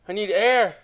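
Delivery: breathless gasp for air; short of air, trailing off; out of breath. Real, not acted. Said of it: "I'm running out of breath".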